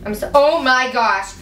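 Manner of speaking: ordinary talk, nothing marked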